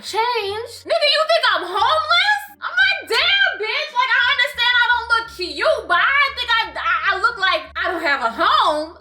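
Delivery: high-pitched, incredulous outburst; hammed-up squawk of disbelief; indignant disbelief. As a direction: react hugely offended, voice way up. Acted indignant reaction to insult.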